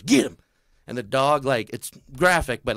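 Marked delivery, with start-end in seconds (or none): 0.0-0.4 loud high command; mock hunter command; dog command. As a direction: sic the dog. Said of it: "He goes".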